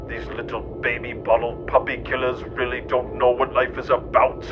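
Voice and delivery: ordinary talk, nothing marked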